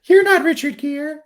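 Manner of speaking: high squeaky voice. Pretend. Joke spoken into talking hamster.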